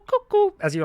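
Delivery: high piping cuckoo call; cuckoo bird call. Retold or imitated. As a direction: chirp it like a clockwork cuckoo. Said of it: "begins cuckoo".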